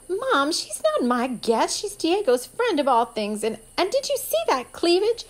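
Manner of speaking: put-on high, disdainful teen girl voice; haughty. A narrator's reading. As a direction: sniff at her like a spoiled daughter. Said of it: "she said haughtily".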